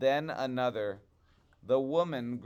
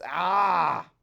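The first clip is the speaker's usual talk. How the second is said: sudden shout; 6 semitones higher, 11 dB louder than usual. A narrator's reading. Wife's outburst in story.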